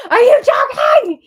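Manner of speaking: high, loud shout of disbelief; loud outrage. Real, not acted. Viewer reacts to cameras switching, shouted disbelief. Outburst at feeds cutting, second take.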